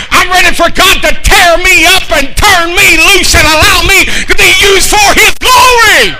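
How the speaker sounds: climbing from talk to a high, loud shout; building preaching. Acted. Pastor declaring he's ready for God, building preaching shout. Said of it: "This is my preacher face".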